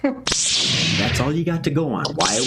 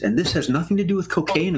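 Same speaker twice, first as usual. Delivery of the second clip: ordinary talk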